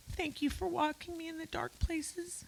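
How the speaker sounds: high, soft, choked-up voice; tearful thanks. Real, not acted. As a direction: thank them while fighting back tears. Eulogy, thanking sisters for support.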